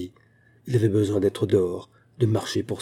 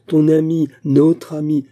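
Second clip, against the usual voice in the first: louder, higher, pressing the point; loud protest; 6 semitones higher, 7 dB louder, slower than usual. A narrator's reading. Husband loudly defending their friend to his wife. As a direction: hammer each word of the defence. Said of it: "cria-t-il".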